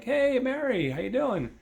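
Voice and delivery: high, loud charming hello; cocky high greeting. Retold or imitated. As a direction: call out to her, high and confident. Steve greeting Mary again.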